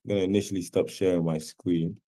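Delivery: ordinary delivery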